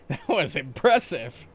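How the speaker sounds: laughing. Real, not acted. Laughing after an impressive shot.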